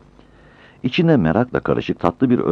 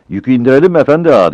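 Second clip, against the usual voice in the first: eager porter; 9 semitones higher, 8 dB louder, much faster than usual. Acted. Porter eagerly asks the shopkeeper.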